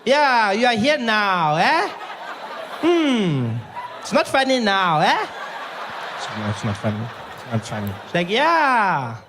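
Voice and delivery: high, loud, slow laughing taunt; taunting receptionist. Retold or imitated. Receptionist taunts the comedian, high, loud and slow. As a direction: savour the revenge.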